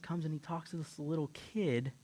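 ordinary talk

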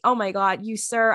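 usual speaking voice

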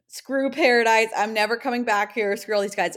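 fake-storm off, grinning; jokingly telling off the guys as she leaves; joking mock rant. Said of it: "her Tia funny way".

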